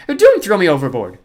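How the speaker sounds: high, very loud frightened plea; pleading. A narrator's reading. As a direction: beg for your life. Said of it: "she pleaded".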